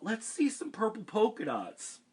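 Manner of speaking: high, loud taunting shout; a classmate heckling Camilla, high and loud; kid yelling. Acted. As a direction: call it out across the class. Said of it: "One yelled out".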